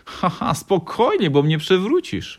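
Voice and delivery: loud, high, slow acted chuckle; laughing. A narrator's reading. Grandfather nearly knocked over by the hug, laughing. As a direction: laugh through it as a jolly grandfather. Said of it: "roześmiał się starszy pan".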